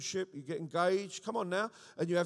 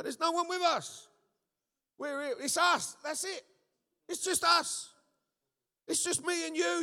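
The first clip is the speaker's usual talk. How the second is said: panicked disbelief; 11 semitones higher than usual. Retold or imitated. Young man's panicked protest.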